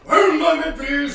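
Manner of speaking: loud, high-pitched shout through the door; shouted in-character call. Acted. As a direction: holler it to whoever just knocked. Character calls through closed door.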